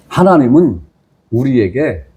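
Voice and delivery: ordinary talk, nothing marked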